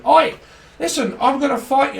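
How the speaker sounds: high, loud mock challenge; hammed-up pub fighter shouting; Blackadder brawler. A brawler picking a fight, mock shouted high. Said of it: "from Blackadder".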